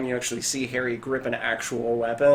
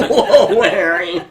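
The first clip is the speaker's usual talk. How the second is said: mock amazed whoa; 5 semitones higher, 9 dB louder, slower than usual. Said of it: "you guys are all like".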